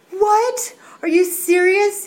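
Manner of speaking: high, loud incredulous what; shocked disbelief. Retold or imitated. Retelling her shock at the five-day rule, loud and high. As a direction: jump up in pitch and volume, stunned.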